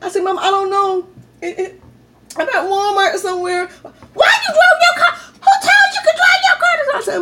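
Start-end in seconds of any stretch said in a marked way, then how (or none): 0.2-3.7 put-on scared kid. Lost teen answering her mom. 4.1-6.7 very high, loud scolding yell; furious mom shout. Retold or imitated. Mom yelling at her daughter for taking the car, very high and loud.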